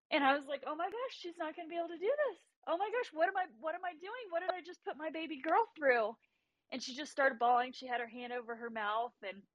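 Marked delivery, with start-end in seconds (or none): 0.7-6.2 high, hurried mock-panicked mom; retold panic. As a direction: replay a worried mother's rising panic. Retold panic as watching mother.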